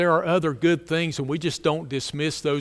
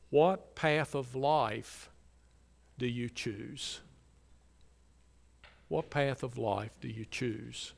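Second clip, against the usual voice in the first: solemn hushed question; 4 semitones lower, 8 dB quieter than usual. Acted. Preacher quietly asking each listener to choose, solemn. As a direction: ask it gently, let it hang.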